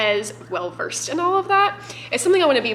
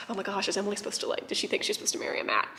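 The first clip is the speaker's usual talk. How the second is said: whispered worry; 6 dB quieter, faster than usual. Retold or imitated. Imagined worried thought, retold.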